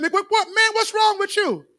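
high, loud mock-exasperated wife; wife teasing her husband, loud and high; wife's exasperated question. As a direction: toss it off like a fed-up wife. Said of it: "Barbara said".

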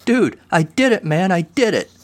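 high, gleeful triumphant cry; excited shout. A narrator's reading. Kevin crowing his triumph to his doubting friend. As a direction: crow with delight. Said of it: "Kevin shouted, laughing".

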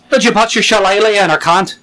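cheeky stage Irish brogue; mock Irish accent. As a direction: tease in a hammed-up Irish voice. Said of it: "accent day".

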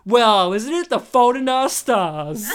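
loud, drawn-out mock teacher quip; imitating a sassy teacher, slow and loud; quipping teacher. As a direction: stretch out the jibe, loudly. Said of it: "she'll see the kid and be like".

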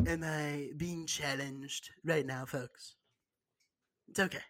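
slow, soft Trump drawl trailing off. Retold or imitated. Joking with his cohost, a slow soft Trump voice. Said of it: "don't make me say it in the Trump voice".

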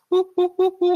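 high hooting monkey calls; monkey noises. Shown as a demonstration. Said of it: "I'm working on the monkey impression".

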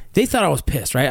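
usual speaking voice

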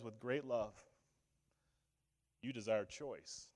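soft, low, slow answer; gentle voice of God. Pretend. Preacher voicing God answering humanity, soft and slow. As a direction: speak as God, hushed and patient. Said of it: "And he says".

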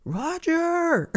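a sudden very high squeal; squealed Roger. Acted. Vlogger cheering the fisherman's coinage, squealed. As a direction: yelp the word up high.

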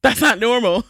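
retold joke breaking into laughter; humorous. Quoting her friend's joke about the brick confession, laughing. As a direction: laugh through the friend's deadpan-style quip. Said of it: "in her humor, says".

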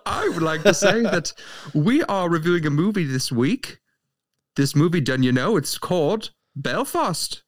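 high, hammed-up Irish brogue; put-on Irish accent. Co-host asks why he's doing Irish voice, hammed-up Irish accent. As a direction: lay on a jolly stage Irish lilt. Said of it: "this half Irish, half Australian".

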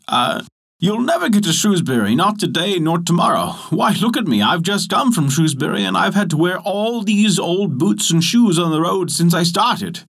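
high, lively cobbler spinning a tale. A narrator's reading. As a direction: talk the giant out of it like a sly cobbler. Cobbler tricks the giant.

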